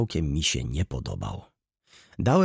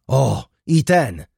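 loud, slow, raised cry; exclaiming; 6 semitones higher, 8 dB louder, much slower than usual. A narrator's reading. Baroness accusing the caretaker, exclaiming. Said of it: "zawołała baronowa".

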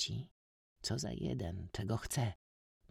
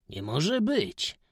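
slow, louder disbelief; astonished exclamation; 8 semitones higher, 6 dB louder, much slower than usual. A narrator's reading. Stranger exclaims at Józka's cut-outs, slow amazement. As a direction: stretch out the disbelief. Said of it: "wykrzyknął".

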